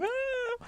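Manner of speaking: thin, very high "aah", held back in volume; high-pitched mock scream. Rider mimics her screaming boyfriend on the back, high mock scream. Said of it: "He was screaming".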